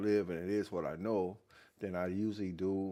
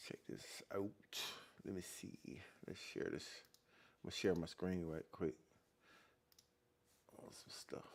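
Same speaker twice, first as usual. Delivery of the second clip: under-breath muttering; 5 semitones lower, 15 dB quieter than usual. Real, not acted. Muttering to himself while sharing screen.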